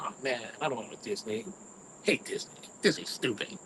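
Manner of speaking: low, soft sulky grumble; grumpy whine. Retold or imitated. To his cousins, refusing the Disney trip, low sulky grumble. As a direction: grumble it like a sulking kid.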